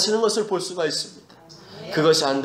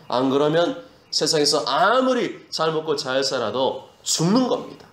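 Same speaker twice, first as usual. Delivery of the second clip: ordinary talk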